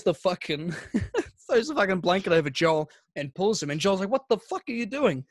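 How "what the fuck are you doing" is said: higher-pitched indignant protest; retold outrage. Joel to the prankster throwing a blanket, indignant. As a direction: imitate someone suddenly grabbed and objecting.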